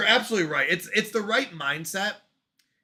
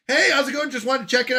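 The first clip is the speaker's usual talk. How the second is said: higher, breezy boss voice; imitated cheery boss; 5 semitones higher, 4 dB louder than usual. Boss opening a check-in meeting, breezy imitation. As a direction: imitate a casual boss popping in.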